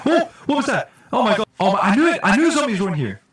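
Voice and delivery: loud, high panicked outburst; startled yelp. Real, not acted. Streamer spots zombies in the house, loud scared. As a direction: jump at the zombie and yell.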